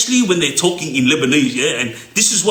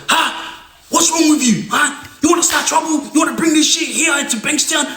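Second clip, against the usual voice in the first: high, heated yelling at the younger men; imitated furious Lebanese elder; angry outburst; 9 semitones higher than usual. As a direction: go off at him in a high, angry rant. Older Lebo going off, shouting.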